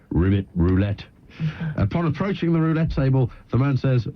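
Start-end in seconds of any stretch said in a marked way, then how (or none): 0.1-1.0 lightly voiced frog, pitched low; talking frog. Frog advises the man in Las Vegas, lightly voiced low. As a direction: drop lower for a hint of a frog. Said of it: "the frog says".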